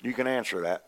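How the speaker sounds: ordinary delivery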